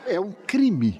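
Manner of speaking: a playful, laughing protest; laughing mock outrage. Real, not acted. Mock outrage over tea.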